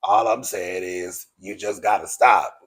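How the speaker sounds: low, droning imitation of a man; mock him as if talking into a box fan. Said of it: "you sound like you talking in front of a box fan".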